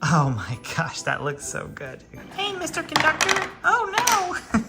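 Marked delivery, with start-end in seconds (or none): 2.4-4.7 high, squeaky toy train voice; playful train voice. Pretend. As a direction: voice the little engine for a child. Parent voices Thomas the train.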